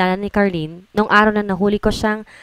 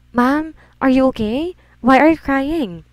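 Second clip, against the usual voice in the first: concerned question; 6 semitones higher, slower than usual. Acted. Employee sees boss crying.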